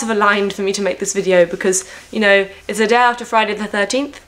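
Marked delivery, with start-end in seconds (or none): none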